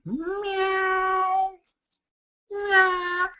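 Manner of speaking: high imitated meowing; cat meow. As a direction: meow like a cat to tease the dog. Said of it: "My dog loves when I do that".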